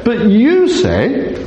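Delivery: "you" lifted and stretched; stress on YOU. Shown as a demonstration. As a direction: contrast "you" against the law. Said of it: "in contrast".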